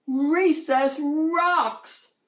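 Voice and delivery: high, slow, stretched bellow. A narrator's reading. Shark pupil at recess, bellowing happily. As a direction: belt it out across the yard. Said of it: "bellowed Clark the shark".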